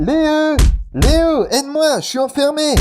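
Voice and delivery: calling out from inside a closet; muffled call for help. Acted. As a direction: call for help from behind a door. The king is locked in a closet.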